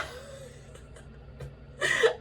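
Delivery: high, airy fit of laughter. Real, not acted. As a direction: crack up at the retold question. Laughs at the retold question.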